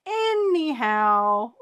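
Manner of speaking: long, high, drawn-out "Anyhow"; sassy slow high "Anyhow". Shown as a demonstration. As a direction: sing it out breezily, dismissing the fear. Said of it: "very simple, very short".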